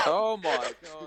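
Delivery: laughing out loud. Real, not acted. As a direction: blurt it through big laughter. Loud burst of laughter at table.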